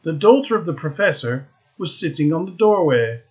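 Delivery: ordinary delivery, nothing marked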